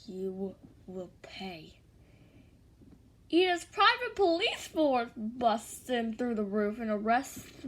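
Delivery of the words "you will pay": quiet, slow vow of revenge; low-volume, drawn-out threat; enraged threat. A narrator's reading. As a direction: say it softly and very slowly, menacing. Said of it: "Then Ida gets enraged".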